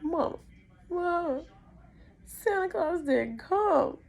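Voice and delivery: very high, drawn-out mock wail; hysterical child. Child waking mom about Santa, hammed-up hysterical wail. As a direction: whine for mom like a distraught kid. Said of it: "I am just hysterical".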